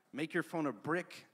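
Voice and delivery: usual speaking voice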